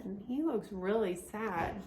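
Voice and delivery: ordinary delivery, nothing marked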